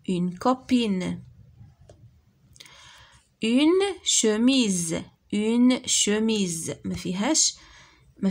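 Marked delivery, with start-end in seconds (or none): 0.1-6.7 very slow, each syllable drawn out; slow modelled words. Shown as a demonstration. Teacher modelling French words for children, slowly. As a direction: read it out slowly for the class. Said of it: "teacher models words slowly".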